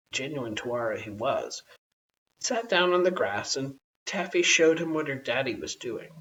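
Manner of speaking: ordinary delivery, nothing marked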